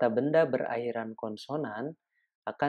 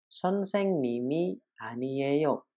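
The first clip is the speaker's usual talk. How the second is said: slow Korean demo; much slower than usual. Shown as a demonstration. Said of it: "Contoh lainnya".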